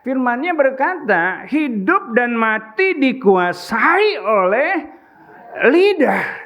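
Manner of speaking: fiery sermon voice; full-force preaching. Acted. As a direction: preach it out to the congregation.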